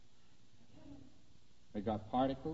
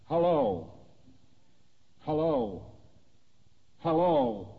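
rising, louder calls of hello, each higher; insistent calling out; 10 semitones higher, 4 dB louder, much slower than usual. Retold or imitated. Lecturer imitates a man calling out, unanswered, with rising hellos. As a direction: call hello into the void, higher each time.

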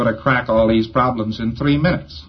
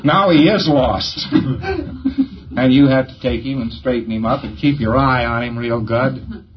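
ordinary talk

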